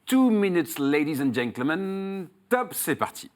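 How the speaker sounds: high, drawn-out showman's call to attention; announcer's countdown. Acted. Host starts the contestant's two minutes, showy announcer's countdown. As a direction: launch the round like a ringmaster, voice dropping after the opening.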